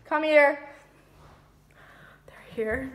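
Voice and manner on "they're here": urgent whisper. Acted. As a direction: hiss it so nobody hears. Hiding from guests, whispering.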